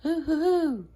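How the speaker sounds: high hooting owl call; imitating an owl across the woods; owl hoots. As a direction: answer like the other owl. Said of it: "an owl going".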